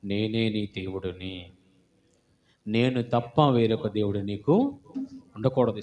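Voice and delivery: low, soft, slow reading of the commandment; solemn scripture reading. Acted. Preacher reads God's commandment to the congregation, slow and hushed. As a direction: let each holy word settle, quietly.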